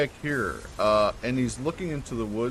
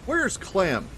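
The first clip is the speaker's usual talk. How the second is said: high, raised call for someone; yelling; slower than usual. Acted. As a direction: yell out the question, pitch up high. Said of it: "You hear him yell".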